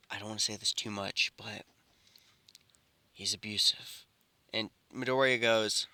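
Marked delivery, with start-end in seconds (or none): 0.1-4.0 quiet, low confidential murmur; confiding up close. A narrator's reading. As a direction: lean in and keep it hushed. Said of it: "Bakugo whisper-, uh, pulls him in close".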